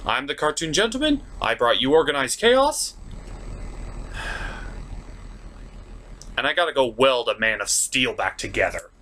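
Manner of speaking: ordinary delivery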